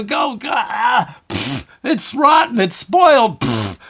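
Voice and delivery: high, loud crying complaint; wailing kid. Retold or imitated. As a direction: wail like a kid who tasted something awful. Kid wailing about rotten food.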